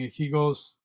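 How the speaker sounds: usual speaking voice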